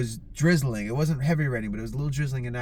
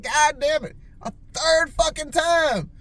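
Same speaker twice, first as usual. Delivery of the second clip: high, drawn-out mock exasperation; exasperated outburst; 10 semitones higher, slower than usual. Smoker retelling a third backwards cigarette, high exasperated cry. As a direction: re-enact mounting disbelief at yourself. Retold exasperation, same mistake repeated.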